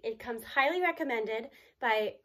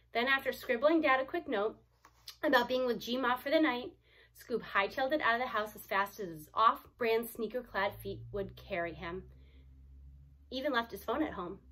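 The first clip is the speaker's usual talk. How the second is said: usual speaking voice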